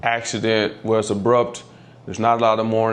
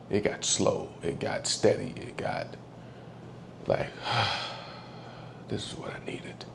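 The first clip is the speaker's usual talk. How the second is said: whispering through tears; barely voiced, drifting into a whisper; soft whisper at the dog's last breaths; hushed whisper; 12 dB quieter than usual. Real, not acted.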